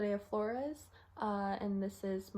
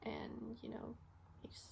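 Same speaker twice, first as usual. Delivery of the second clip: hushed, halting account of a death; quiet, reluctant confession; subdued grief; 10 dB quieter than usual. Acted. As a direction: speak softly, with long heavy pauses. Acted recollection of finding patient dead.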